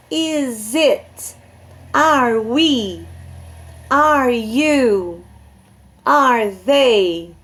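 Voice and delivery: slow, evenly spaced English model questions; deliberate classroom drill, one question at a time. Shown as a demonstration. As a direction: recite each short question separately for learners.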